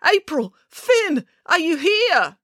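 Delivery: high, drawn-out names; calling out. A narrator's reading. Dad looks for his children in the crowd, calling out. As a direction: search the room with your voice.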